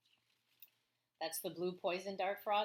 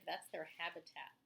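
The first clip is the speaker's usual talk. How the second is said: ordinary talk, nothing marked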